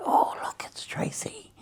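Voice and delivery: hushed, uneasy whisper; worried whisper. Retold or imitated. Whispering as Tracy rings, expecting bad news.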